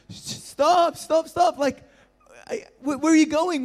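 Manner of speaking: high, loud panicked yelling; yelling after car. Retold or imitated. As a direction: shout after the car as it leaves. Kids chasing the car yelling.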